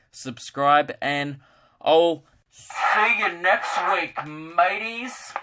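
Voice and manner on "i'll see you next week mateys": drawn-out, put-on farewell call; silly sign-off voice. Podcaster signs off to listeners in a slow, silly put-on voice. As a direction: stretch the goodbye out in a silly voice.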